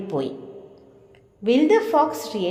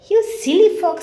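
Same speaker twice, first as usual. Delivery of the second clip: higher, louder scornful jeer; mocking taunt; 7 semitones higher, 5 dB louder, slower than usual. Shown as a demonstration. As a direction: tease the fox. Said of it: "repeats the mouse's taunt". (ഉറക്കെ കളിയാക്കുന്നു)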